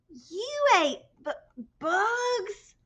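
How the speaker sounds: slow, high, disgusted stammer; stuttering in shock. A narrator's reading. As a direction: trip over the word in disbelief. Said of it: "stuttered one".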